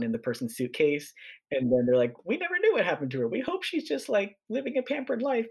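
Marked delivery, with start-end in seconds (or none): 2.2-5.5 high-pitched, put-on wistful voice; mock-hopeful and high; hopeful relatives. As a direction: imitate relatives kidding themselves, pitched well up. Voicing relatives wondering about lost aunt.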